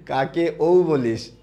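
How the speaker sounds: slow mock mother asking; mother puzzled at her child's chanting; imitating mom. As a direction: mom asks who the child is calling. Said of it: "আম্মু বলে".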